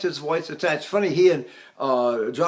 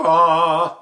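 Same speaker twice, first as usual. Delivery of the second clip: louder ah dropping out of falsetto; full chest ah; 7 dB louder than usual. Shown as a demonstration. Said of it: "is this falsetto attachment".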